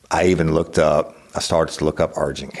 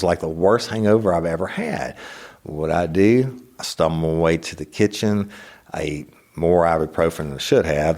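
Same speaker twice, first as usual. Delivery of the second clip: ordinary talk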